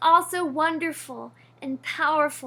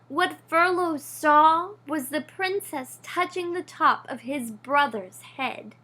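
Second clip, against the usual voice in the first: usual speaking voice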